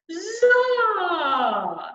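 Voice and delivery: long resonant "za" swooping up, then down; all spaces open. Shown as a demonstration. Coach combining all three spaces, big yawning resonance. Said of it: "All three spaces".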